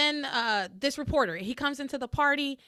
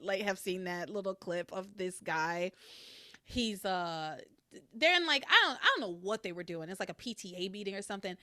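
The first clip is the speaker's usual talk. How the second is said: ordinary talk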